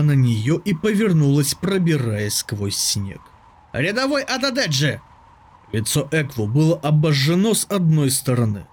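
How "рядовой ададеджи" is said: high raised call to a soldier. A narrator's reading. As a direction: summon her across the snow. Captain calls the soldier.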